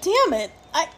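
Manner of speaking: half-mock frustrated cry; retold frustration. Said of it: "and I was like".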